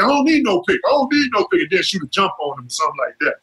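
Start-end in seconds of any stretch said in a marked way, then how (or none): 0.0-1.6 mock taunt.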